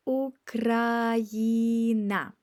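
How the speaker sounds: Україна drawn out very slowly; slow careful model of Україна; slow demo. Shown as a demonstration. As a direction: stretch every syllable of Україна. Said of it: "you say Україна".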